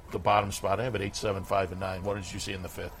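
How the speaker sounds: ordinary talk, nothing marked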